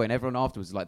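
ordinary talk

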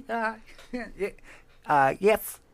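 ordinary delivery

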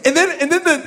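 ordinary delivery

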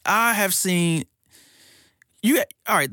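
usual speaking voice

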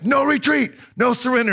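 high mock shout of the motto; movie shout. Movie buddy shouting encouragement from the stands, high mock shout. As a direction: cheer him on from the stands. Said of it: "his buddy from the stands yells at him".

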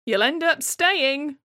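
high, loud, drawn-out quote; mimicking what people always say; teasing prediction. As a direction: sing out the prediction slowly and high. Others' horrifying prediction, quoted.

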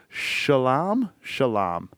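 Shulam said slowly as shuh-LAHM, twice over; tentative pronunciation. Shown as a demonstration. Podcaster unsure how to pronounce a busker's name, tentatively trying Shulam. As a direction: sound out Shulam carefully, unsure, stretching the second syllable. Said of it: "don't even really know how to pronounce".

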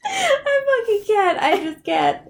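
high, slow, laughing too hard to talk; can't stop laughing; laughing helplessly. Real, not acted. Cracking up.

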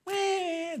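high, soft mock wail; imitated child's crying. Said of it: "just crying".

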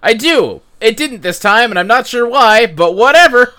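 real flustered self-justifying, rising to a loud finish; exasperated defence. Real, not acted. Defends themselves when teased about algorithm.

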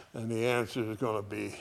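ordinary delivery

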